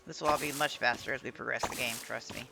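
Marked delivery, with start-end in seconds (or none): none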